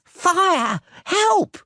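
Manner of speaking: high panicked yell for help; shrill-pitched panicked yell. A narrator's reading. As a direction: scream the alarm. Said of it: "it yelled".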